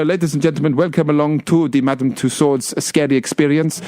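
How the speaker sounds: ordinary talk, nothing marked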